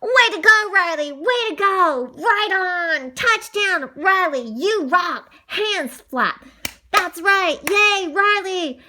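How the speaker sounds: high, jubilant team cheers; cheering. A narrator's reading. Teammates celebrating Riley's touchdown, excited cheering. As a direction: whoop for your teammate's score. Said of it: "jumping up and down and cheering".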